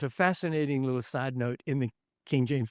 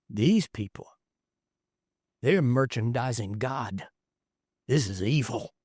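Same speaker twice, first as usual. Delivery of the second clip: gentle Jesus explaining; 5 semitones lower than usual. Retold or imitated. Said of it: "he would have said".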